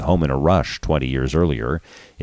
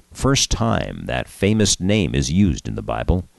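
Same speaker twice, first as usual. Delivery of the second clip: ordinary delivery, nothing marked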